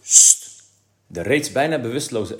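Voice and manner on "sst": loud voiceless shush. A narrator's reading. Father silencing Kees with a loud shush. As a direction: shush the boy sharply.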